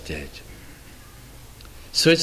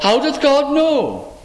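high, slow scoffing question; mocking scoffer; 9 semitones higher, slower than usual. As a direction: sneer it like a doubter mocking God. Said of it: "this is how they talk".

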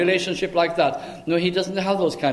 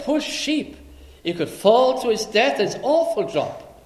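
higher-pitched, worried pity; alarmed pity; 6 semitones higher than usual. Retold or imitated. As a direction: fret for the poor sheep. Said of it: "And you feel".

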